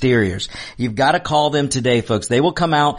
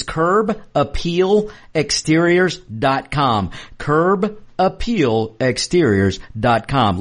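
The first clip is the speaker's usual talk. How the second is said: slow; much slower than usual. Acted. Slow spelled-out web address.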